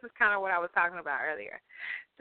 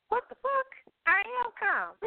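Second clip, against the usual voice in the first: much higher, incredulous outburst, read aloud; exasperated disbelief, quoting a comment; indignant read-out. Retold or imitated. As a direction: read it like you can't believe they said it.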